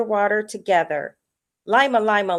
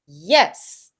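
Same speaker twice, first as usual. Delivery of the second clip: exclaimed yes; 4 semitones lower, 4 dB louder than usual. A narrator's reading. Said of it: "exclaims Lima Lima".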